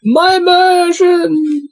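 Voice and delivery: high, drawn-out mock wail; mock outcry. Player teasing the table over misgendered names, mock outcry.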